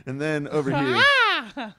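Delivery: slow, high and loud game-show build-up; mock announcer. Host introducing the next cohost like a mock ring announcer, slow and loud.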